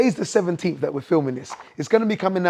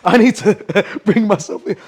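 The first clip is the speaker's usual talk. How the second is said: laughing; 4 semitones higher, 6 dB louder than usual. Retold or imitated. Host laughs while voicing story.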